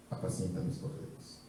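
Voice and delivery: breathy whisper, very soft. Acted. Jesus telling Peter to feed his lambs, whispered. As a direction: breathe the command out.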